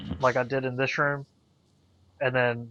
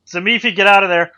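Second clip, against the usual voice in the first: urgent yell, much louder; shouting; 6 semitones higher, 14 dB louder than usual. Acted. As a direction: shout a frantic warning across the room. Warning shout to Zamifi.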